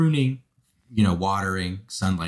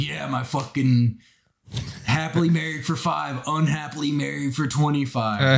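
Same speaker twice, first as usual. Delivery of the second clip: exaggerated bro voice; mock a smug married guy; mocking straight guy; 4 semitones higher than usual. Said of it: "they're always like".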